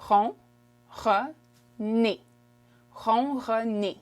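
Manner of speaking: ronronner slowly as ron, ron, ner; syllable-by-syllable demo. Shown as a demonstration. Teacher shows purr in French, ronronner slowly by syllables. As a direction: break ronronner apart, then join it slowly. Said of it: "So purr in French is".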